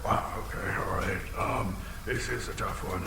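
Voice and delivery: soft, low pondering murmur; muttering to himself. Acted. Dragon puzzling over the riddle, soft low muttering. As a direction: think it through under your breath.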